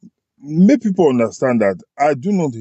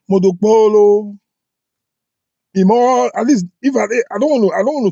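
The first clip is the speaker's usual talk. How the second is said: exasperated outburst; 8 semitones higher, 4 dB louder than usual. Real, not acted. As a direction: throw the name out in exasperation. Outburst naming the governor.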